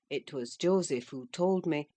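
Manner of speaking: ordinary delivery, nothing marked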